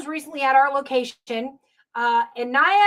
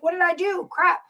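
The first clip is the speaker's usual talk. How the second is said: put-on higher voice of a kid who thinks he's in trouble; a child startled by a stern dad calling; scared kid; 7 semitones higher than usual. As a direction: squeak it out like a kid caught out. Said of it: "like you're a kid".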